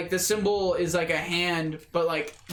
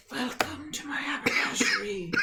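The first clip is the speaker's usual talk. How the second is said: high, raspy, hushed voice of a hooded creature; 8 semitones higher than usual. Acted. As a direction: rasp it out like a disjointed shopkeeper thing. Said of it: "a raspy voice, but not out loud".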